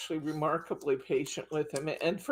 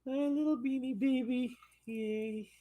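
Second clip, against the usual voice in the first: high doting coo; 8 semitones higher than usual. Real, not acted. Owner cooing high at her little dog.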